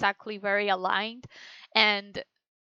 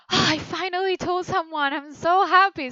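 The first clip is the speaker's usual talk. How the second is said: happy relief; 7 semitones higher than usual. Shown as a demonstration. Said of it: "it was like".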